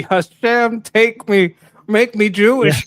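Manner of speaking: high, loud hammed-up plea; mock prayer. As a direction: cry out to Hashem like a mock desperate prayer. Quoting a favorite comment as mock prayer.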